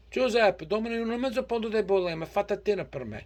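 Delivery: high, loud, fast Italian mom ordering; Italian American mother. Retold or imitated. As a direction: call the butcher like an Italian mom. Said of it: "She says".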